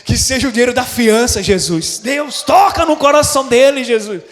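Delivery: loud, eager pleading to God; excited prayer. Retold or imitated. Pastor praying for the bail money, loud and eager. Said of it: "fiquei empolgado e falei".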